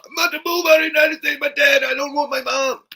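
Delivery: high, fast, shouted fake sobbing; hammed-up crying whine; mock crying girl. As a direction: wail it like a spoiled kid threatening to leave. Mocking complainer again, crying.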